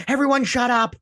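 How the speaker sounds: sudden high yelled order; mock shout. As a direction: bark it at the whole room like a stern alien. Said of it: "And he's like, he's like".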